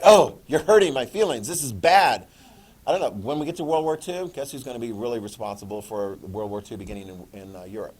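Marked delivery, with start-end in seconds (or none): 0.0-2.3 loud mock-wounded protest; loud, hammed-up complaint; mock hurt. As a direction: play up the hurt feelings loudly. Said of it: "that's just sort of like".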